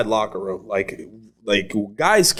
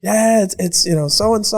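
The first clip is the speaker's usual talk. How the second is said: high mock girl voice; imitated girl; 10 semitones higher than usual. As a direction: answer in a much higher, put-on voice. Imitating the girl's answer.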